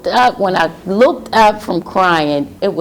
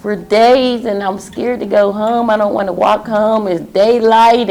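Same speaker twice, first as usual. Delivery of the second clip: higher, pleading, a touch scared; retold fearful plea; 6 semitones higher than usual. Woman telling the nuns she's scared to go home, mild fear.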